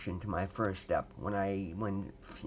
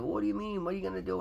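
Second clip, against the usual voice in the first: higher, puzzled boss voice; mock boss; 8 semitones higher, faster than usual. Boss asks why he quits, in a higher puzzled mock voice. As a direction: play the baffled boss, pitched up.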